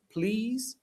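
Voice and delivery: PLEASE drawn out high with a long "ee"; high, stretched PLEASE, rising at the end; pronunciation model. Shown as a demonstration. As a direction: model the vowel in PLEASE for learners. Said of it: "Se pronuncia como please".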